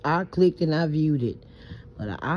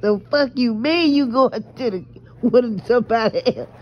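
mock outrage; 6 semitones higher, 4 dB louder than usual.

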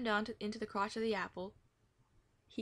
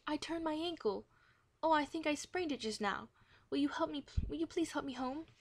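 high, whiny plea of a faked sprained ankle; moaning plea; 7 semitones higher than usual. A narrator's reading. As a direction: beg for help, milking the pain. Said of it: "Marcia moaned".

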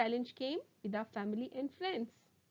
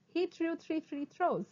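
higher, quicker take; sped-up tongue twister; 4 semitones higher than usual. Shown as a demonstration. Teacher speeds up the twister for students, briskly. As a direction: run the words together briskly. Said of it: "speed up".

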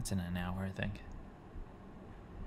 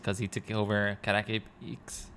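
ordinary talk

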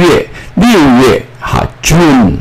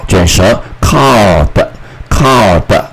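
slow curled-tongue demo; slower than usual. Shown as a demonstration.

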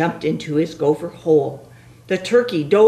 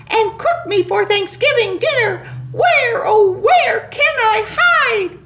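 panicky turkey voice; 3 dB louder than usual. Acted.

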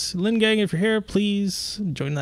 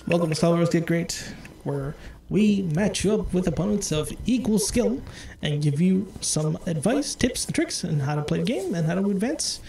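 stream host intro; 4 semitones higher than usual. Acted. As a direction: welcome viewers like a show host. Opens the stream show.

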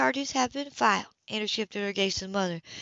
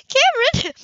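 high, loud, delighted cry of his name; startled joy at a visitor; surprised call; 10 dB louder than usual. A narrator's reading. Surprised to see him at door.

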